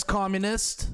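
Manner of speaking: ordinary talk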